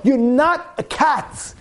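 slow, deliberate phrases; slow, weighty sermon point; slow emphatic preaching. Acted. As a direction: spell it out slowly to the crowd.

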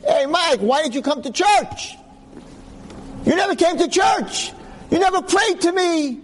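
high-pitched teasing judge; mock Jesus scolding. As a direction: scold him like a disappointed deity. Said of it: "he's not gonna talk like that".

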